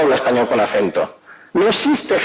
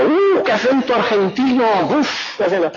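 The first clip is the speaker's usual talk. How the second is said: mock astonishment; 5 semitones higher than usual. Madrileño hearing an Argentine, mock astonished. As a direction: marvel exaggeratedly, then puff it out. Mimics reaction to Argentine accent.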